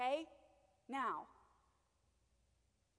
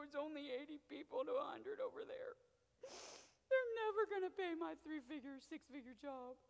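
higher, tearful mock whimper; imagined whining; 6 semitones higher, 4 dB louder than usual. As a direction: whine like a man beaten down. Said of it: "crawl in a corner".

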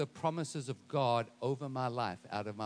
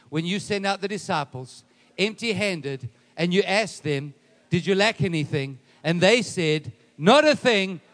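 preaching that builds louder; building declaration; 3 semitones higher, 9 dB louder than usual. Shown as a demonstration. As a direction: build to a loud, emphatic proclamation. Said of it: "say it like this".

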